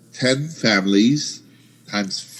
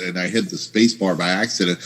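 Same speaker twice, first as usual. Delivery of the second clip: usual speaking voice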